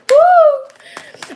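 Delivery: high whooping cheer; excited woo. Real, not acted. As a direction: let out a loud happy woo. Excited cheer during reading.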